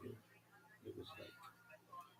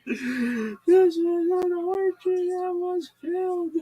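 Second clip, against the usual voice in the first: very high, loud, slow sobbing wail; wailing caller; 12 dB louder, slower than usual. Retold or imitated. Caller sobbing to the host, loud wailing. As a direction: blubber it out, stretched and loud.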